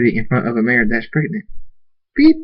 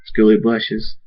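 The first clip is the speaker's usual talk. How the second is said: plain reading voice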